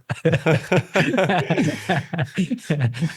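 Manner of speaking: long amused laugh; real laugh. Real, not acted. Podcast guests joking about a Fitbit left in a drawer, amused laugh. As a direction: laugh along at the joke.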